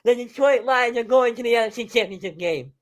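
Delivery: high, ringing victory call; jubilant announcer call. Real, not acted. Announcer proclaims the Lions' first title-game trip since nineteen ninety-one, excitedly. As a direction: proclaim the historic win at a high pitch. Calling a historic Lions win.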